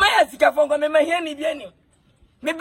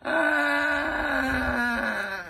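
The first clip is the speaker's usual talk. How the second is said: one long, held "aah" on a level tone; drawn-out "aah". Acted. Reacting to word of Kwadwo Dixon, long drawn-out "aah". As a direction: let the "ah" stretch right out.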